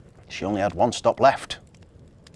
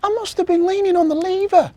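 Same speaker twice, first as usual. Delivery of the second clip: very high, loud little elf voice; elf's cry; 10 dB louder than usual. A narrator's reading. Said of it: "cried Marzy".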